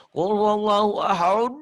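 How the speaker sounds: chanted Quranic recitation; Quran recitation demo. Shown as a demonstration. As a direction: recite it as a teacher's model. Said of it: "kol-kolah gede".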